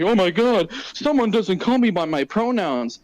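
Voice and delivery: high, drawn-out mock complaint; hammed-up offended whine; mock outrage. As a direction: exaggerate a petty grievance, high and slow. Mocking someone complaining about pronouns.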